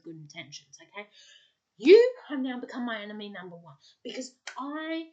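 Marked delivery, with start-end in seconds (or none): 1.8-3.8 loud, emphatic verdict; loud declaration. Real, not acted. To the friend who made her spiral, a loud verdict. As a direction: hammer the verdict home.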